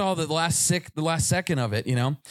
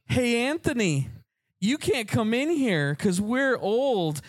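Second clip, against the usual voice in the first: high, slow sing-song gloating; slow taunting tease; 7 semitones higher, slower than usual. Retold or imitated. Teasing the seventeen-year-old friend, slow high taunt. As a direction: rub it in to the younger kid.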